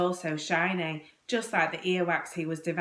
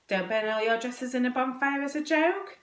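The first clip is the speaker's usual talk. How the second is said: higher-pitched questioning guess, rising; 6 semitones higher than usual. A narrator's reading. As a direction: venture an answer like an unsure boy. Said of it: "guessed Dick".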